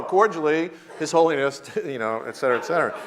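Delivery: normal talking voice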